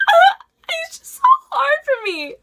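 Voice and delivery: very high, loud sobbing wail; mock loud crying. Teacher overheard crying in her classroom, hammed-up wail. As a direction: imitate a teacher wailing in tears. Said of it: "like, loud crying".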